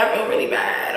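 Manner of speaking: soft, breathy whisper of regret; real sadness, barely voiced. Real, not acted. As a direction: drop to a whisper as it sinks in. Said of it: "I am getting emotional".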